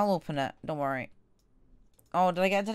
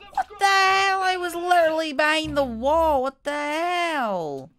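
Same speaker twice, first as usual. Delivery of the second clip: high, loud protest; high-pitched outraged complaint; indignant wail; 9 semitones higher, 4 dB louder than usual. Real, not acted. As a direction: whine loudly at the unfairness. Killed behind a wall.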